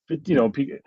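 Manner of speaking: ordinary talk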